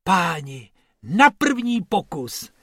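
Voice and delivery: high, slow amazed cheer. A narrator's reading. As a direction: rejoice with stretched-out delight. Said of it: "jásal Bobek".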